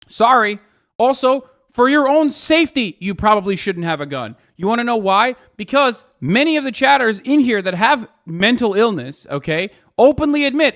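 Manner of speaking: loud, high yelling at chat. Real, not acted. Streamer yelling at chatters about guns and safety, loud. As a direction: let it rip, heated.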